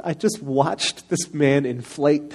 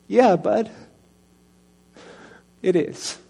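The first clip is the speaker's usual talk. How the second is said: higher, choked-up answer; 7 semitones higher, slower than usual. Retold or imitated. Dad admits to his sons he is sad, choked up. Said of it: "And I just started crying".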